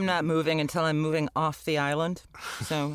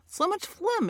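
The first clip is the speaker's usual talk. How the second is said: put-on Yiddish accent; 11 semitones higher than usual.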